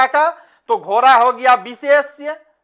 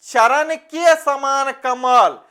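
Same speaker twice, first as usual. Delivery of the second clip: slow explanation; slower than usual. Shown as a demonstration. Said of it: "अभी बताए trick के समान मिलेगा".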